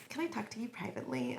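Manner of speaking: quiet, quick, secretive ask; hushed quote. Retold or imitated. Quoting a shy client.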